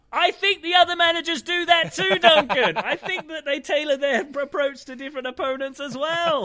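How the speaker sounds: high sarcastic put-on voice with a laugh; mock sarcastic reply. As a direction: talk back to the absent journalist sarcastically. Mocking reply to the journalist.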